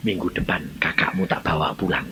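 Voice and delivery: low, soft solemn announcement; God's quiet voice. Retold or imitated. God telling brother his sister goes home, quietly solemn. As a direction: deliver it low and hushed, with gravity.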